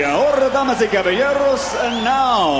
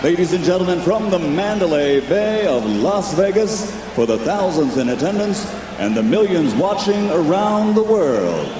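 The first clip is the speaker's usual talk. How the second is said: ring announcer; 3 semitones lower than usual. Acted.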